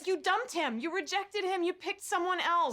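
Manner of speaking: exasperated mother confronting her daughter; heated rebuttal. Acted. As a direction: argue back insistently, voice climbing. Mother arguing back at daughter.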